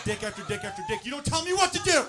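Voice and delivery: mock teen defiance rising to a high yell; defiant teenage daughter. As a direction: build to a loud rebellious outburst at Dad. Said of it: "she's thinking".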